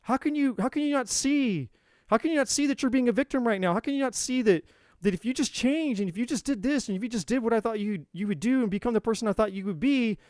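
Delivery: a fed-up rescuer scolding the victim, high and exasperated. Retold or imitated. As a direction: demand to know why they can't see it. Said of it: "the rescuer gets pissed off and it's like".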